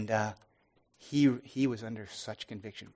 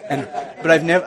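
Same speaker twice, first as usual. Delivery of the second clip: laughing; 4 semitones higher, 13 dB louder than usual. Real, not acted. Amused at swearing in prayer.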